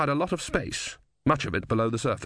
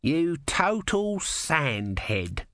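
slow, drawn-out scream at no great volume; narrator's held-back scream of abuse; mock scream; 5 semitones higher, much slower than usual. A narrator's reading. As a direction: stretch each insult out in outrage. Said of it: "screamed Om".

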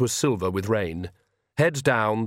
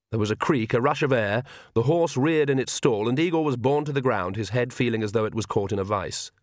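ordinary delivery

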